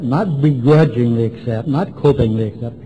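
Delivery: usual speaking voice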